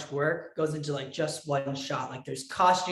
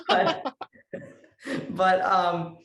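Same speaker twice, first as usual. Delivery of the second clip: ordinary talk, nothing marked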